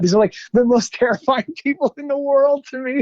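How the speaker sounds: giggling at how scared he was; nervous laughter. Real, not acted. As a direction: laugh it out in disbelief. Laughing at his own terror.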